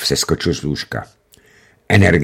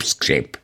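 the last creak, low and soft, dying away; fading creak; 6 semitones lower, 6 dB quieter than usual. A narrator's reading. Narrator imitating the prosthesis creak as it fades. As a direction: let the creak die out, lower and softer. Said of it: "protézy skřípou".

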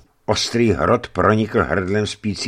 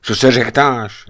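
annoyed; 5 semitones higher, 6 dB louder than usual. A narrator's reading. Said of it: "zvolal mrzutě".

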